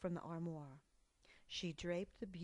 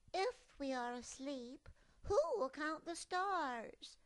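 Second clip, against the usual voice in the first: high, slow worried cub; a child fretting; 9 semitones higher, 5 dB louder than usual. Acted. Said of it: "she fretted with deepening gloom".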